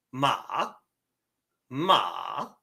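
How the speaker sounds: "ma" rising like a question; long, upward-sliding "ma"; second tone. Shown as a demonstration. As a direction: start "ma" low and raise it up. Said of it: "your second tone sounds like".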